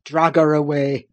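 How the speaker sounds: low, savage command; savage cry. A narrator's reading. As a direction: order it fiercely in a deep voice. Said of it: "he cried savagely".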